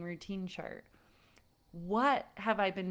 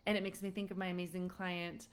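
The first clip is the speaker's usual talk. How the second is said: ordinary talk, nothing marked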